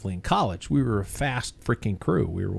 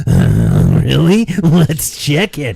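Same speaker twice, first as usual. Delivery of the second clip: mock surprise; 3 semitones higher, 11 dB louder, much slower than usual. Imitating own reaction to notification.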